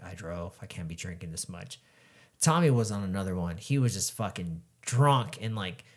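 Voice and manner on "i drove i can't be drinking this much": muttering to self. Retold or imitated. Own sober thought.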